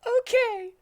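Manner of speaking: put-on high squeaky girl voice; Amy's voice. A narrator's reading. Narrator voicing Amy agreeing to leave, high and squeaky. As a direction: pitch way up for Amy. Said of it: "said Amy".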